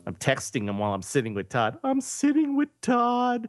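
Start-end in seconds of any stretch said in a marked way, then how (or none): 1.8-3.5 high, drawn-out crowing; gleeful mock boast. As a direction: stretch it out high, showing off. Replays the text with glee.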